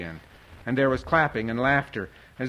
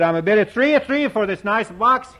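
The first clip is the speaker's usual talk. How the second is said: louder, higher auctioneer's call; firm auctioneer; 9 semitones higher, 6 dB louder than usual. A narrator's reading. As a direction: firmly open the bidding like an auctioneer. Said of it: "spoke up firmly and said".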